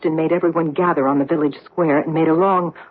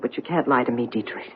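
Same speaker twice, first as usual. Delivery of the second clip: drops much lower and softer on the name; menacing hush; 6 semitones lower, 6 dB quieter than usual. Acted. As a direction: let the name fall like a verdict, low and soft. Naming his true identity.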